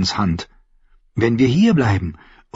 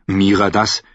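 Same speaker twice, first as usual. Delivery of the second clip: plain reading voice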